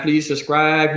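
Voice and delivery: ordinary delivery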